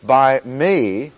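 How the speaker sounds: "me" pushed up and held before a pause; emphatic "me", set apart; stress on ME. Shown as a demonstration. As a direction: punch the word "me". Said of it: "every one of the words we stressed".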